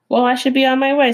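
a lightly voiced opossum hurrying off. Opossum character voice.

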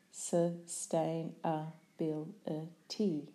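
"Sustainability" slowly, syllable by syllable; split into syllables. Shown as a demonstration.

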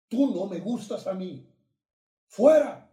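high, loud rebuke building to a bellowed ¡Fuera!; preacher ordering the demon out, ending in a huge shout; commanding shout. Retold or imitated. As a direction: cast it out with full force.

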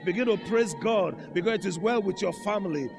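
rising revival sermon, last line high and held long; full-force preaching. Acted. Preacher urging the church to praise God, full-force preaching. Preaches building to a shouted climax.

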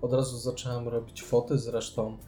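ordinary delivery

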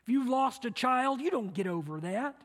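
usual speaking voice

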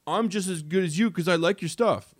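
high-pitched parody of a presumptuous fan; mock fanboy. Voicing what a fan really means to the star, mock fanboy. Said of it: "that's what fanboyism is".

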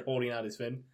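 ordinary delivery, nothing marked

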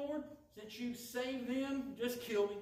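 slow, high, loud melodramatic plea; hammed-up despair; Jonah's plea. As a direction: wail it like a sulking prophet. Jonah voiced begging for death.

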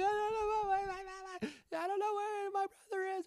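put-on very high, slow, wailing child voice; crying little girl. The hurt little girl wails about her missing brother, very high and tearful. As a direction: whimper it like a lost small child.

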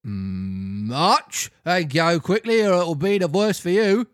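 slow mock rough officer order; a hint of a gruff copper barking. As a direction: officers march Pinocchio off roughly. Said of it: "said to him in a rough voice".

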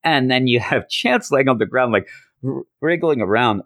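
ordinary talk, nothing marked

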